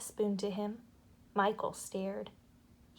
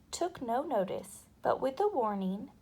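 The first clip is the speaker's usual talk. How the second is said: plain reading voice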